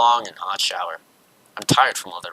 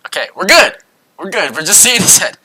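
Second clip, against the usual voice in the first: flustered; 7 semitones higher, 6 dB louder than usual. Real, not acted. Panicked reaction to naked guy.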